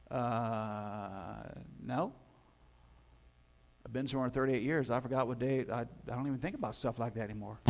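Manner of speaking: quiet clueless reply; soft sheepish shrug. Acted. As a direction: brush it off sheepishly. Healed man shrugs off.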